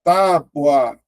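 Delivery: tábua said slowly, split as TÁ-bua; syllabified word. Shown as a demonstration. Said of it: "ó".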